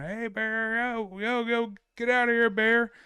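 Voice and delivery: very high, loud ranger yell; ranger screaming. Retold or imitated. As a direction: chase a bear off with a full scream. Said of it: "like, straight screaming".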